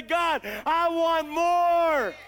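slow, loud cry to God; shouted prayer. Pretend. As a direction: stretch it out and cry it to heaven. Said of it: "open yourself up and say".

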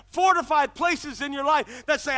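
normal talking voice

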